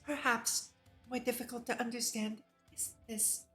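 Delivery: ordinary delivery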